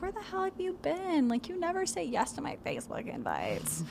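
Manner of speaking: high mock nagging friend; whiny friend. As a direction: pester like an annoyed friend. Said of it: "if she keeps going with that".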